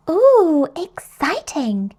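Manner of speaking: loud high ooh, then slow; thrilled ooh, savour exciting; excited Peppa. Acted. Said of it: "says Peppa".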